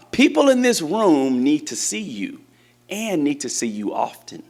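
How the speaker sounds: ordinary talk, nothing marked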